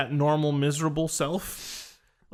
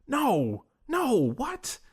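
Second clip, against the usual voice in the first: high, swooping mock protest; retold disbelief, voice leaping up; imitated bewildered refusal; 6 semitones higher, much slower than usual. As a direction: act out his baffled, stubborn refusal. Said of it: "he kept saying".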